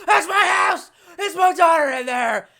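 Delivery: high, loud desperate scream at the police line; hammed-up father shouting to get past the tape; pretend frantic yell. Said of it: "that dude at the crime scene trying to hold me back".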